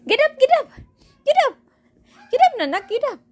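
high and loud command to the pet; loud high urging. Real, not acted.

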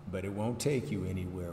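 ordinary talk

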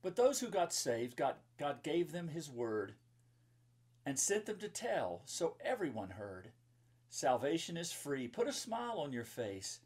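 bright, sing-song rhyming read-aloud; lilting storybook verse; reading to children. Acted. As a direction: read the rhyme to a room of kids. Reads rhyming children's book.

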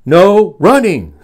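loud, high, drawn-out scolding call; calling after. Acted. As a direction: call out the rule down the hall. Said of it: "Miss Merriweather called after him".

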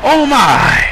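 loud startled cry of surprise; excited shout. Real, not acted. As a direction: shout it out in shock. Commentator reacting to a big move.

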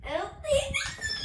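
high, fast panicked yell; panicked shout. Real, not acted. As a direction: blurt it out in panic. Sudden panic in game.

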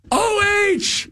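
very high, stretched fan holler; mock scream. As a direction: imitate someone screaming it out across a gym. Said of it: "he, he screams out".

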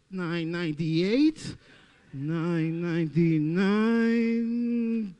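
stretched slow numbers like a gas meter; slow meter countdown. Acted. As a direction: drag each number out. Imitating slowing gas meter.

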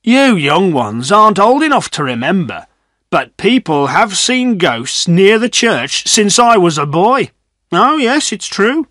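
put-on high-pitched old man's voice; old villager. A narrator's reading. Old man telling younger villagers about ghosts, insistent. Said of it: "agreed old Mister Macy".

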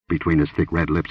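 usual speaking voice

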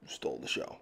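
"stole the show" breathed in a whisper; whispered aside. Real, not acted. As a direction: let it slip out as a secret whisper. Whispered repeat of praise.

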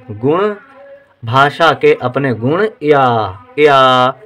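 ordinary talk

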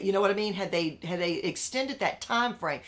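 ordinary delivery

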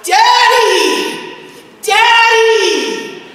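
put-on high, loud child's wail; child crying for daddy. Injured son crying out for his father, wailing high. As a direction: cry out like a hurt little boy. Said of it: "And then Robert goes".

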